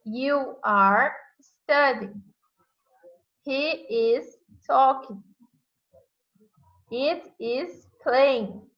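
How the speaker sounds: each English example said very slowly and clearly; slow, clear classroom demo; slow example sentences. Shown as a demonstration. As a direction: spell out the sentence slowly for students. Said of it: "verbo principal e o complemento".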